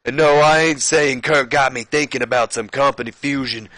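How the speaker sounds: high, loud wrestler promo; hammed-up impression sounding like Stone Cold; Booker T. Champion answering talk of company fusion, loud put-on promo. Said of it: "This is still Stone Cold".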